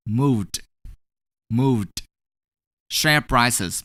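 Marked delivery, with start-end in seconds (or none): 0.1-2.0 "moved" as one syllable ending in d. Shown as a demonstration. Teacher modelling "moved" for learners, ed pronounced as d. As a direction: demonstrate "moved" with a voiced d after the v. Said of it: "we're gonna pronounce it as".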